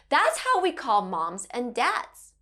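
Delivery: usual speaking voice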